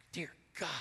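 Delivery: a breathless whispered plea; whispered prayer. Retold or imitated. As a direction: whisper it in panic.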